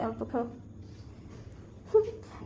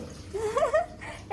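high, soft laugh; giggle; 10 semitones higher, 7 dB quieter than usual. Real, not acted. Parent amused during the birthday party, giggling.

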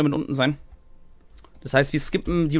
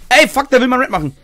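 excited; 8 semitones higher, 6 dB louder than usual. Real, not acted. Aufgeregt im Spiel.

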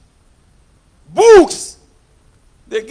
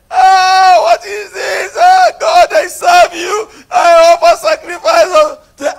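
loud, higher crying plea to God; Job crying out; 22 dB louder, slower than usual. Retold or imitated. Preacher voicing Job's grief to the congregation, crying out. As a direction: wail it out as a man who lost everything. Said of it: "And when he was crying".

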